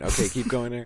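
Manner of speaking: ordinary talk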